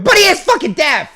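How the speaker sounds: high, loud insistent shout; belted-out comic protest; emphatic yell. Real, not acted.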